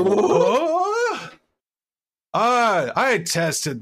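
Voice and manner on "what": high wailing what, louder; a high, loud, crying what; shocked what. Real, not acted. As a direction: cry it out in mock outrage. Reacting to joke, heard cry.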